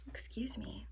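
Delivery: soft unvoiced excuse me; whispered excuse me. Real, not acted. Apologizing to viewers after a burp, whispering. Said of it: "a little burp".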